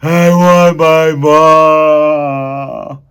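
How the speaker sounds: high, loud, slow wail; crying out for ma. Acted. Meg crying for her mother, high, loud and slow. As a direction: wail it into the silence. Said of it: "cried Meg".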